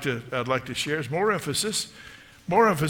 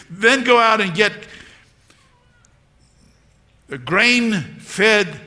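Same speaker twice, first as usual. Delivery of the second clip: loud, raised-pitch orders from an elated father; preacher acting a father summoning his servants; calling to servants; 6 semitones higher, 8 dB louder than usual. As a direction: call out commands across the household. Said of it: "He was calling to his servants".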